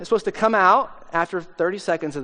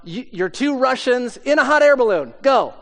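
louder, higher mock shout; shouted prompt; 6 semitones higher, 4 dB louder than usual. Audience member shouting a scene to improvisers, mock shout. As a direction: call it out like an audience suggestion. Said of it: "then somebody shouts out".

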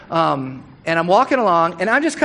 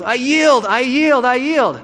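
raised, pleading yield from a half-drowned man; sputtering surrender; 7 semitones higher, 4 dB louder, slower than usual. Retold or imitated. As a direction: gasp it out like you're being dunked. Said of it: "he's, like, sputtering, coughing".

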